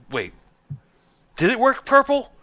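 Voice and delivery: high, loud call; scatterbrained shop owner. Acted. Shop owner checking with her drake, high and uncertain.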